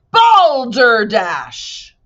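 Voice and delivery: loud, drawn-out booming voice; booming shout. A narrator's reading. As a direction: bellow it slowly. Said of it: "shouted a booming voice".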